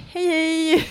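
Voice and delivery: high-pitched drawn hello; eager mock greeting. Recruiter in a beard greeting visitors, eager and high. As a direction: greet strangers, pitched well up.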